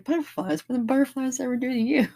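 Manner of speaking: high, quick, indignant Doctor Who voice; Tennant Doctor impression. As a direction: bounce up high and fast, outraged on butterflies' behalf. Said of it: "David Tennant's Doctor Who, it was like".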